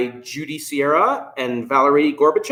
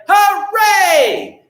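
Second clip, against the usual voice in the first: high, loud cheer of hooray; shouted hooray; 5 dB louder than usual. Pretend. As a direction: cheer it out to the kids. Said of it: "shout hooray".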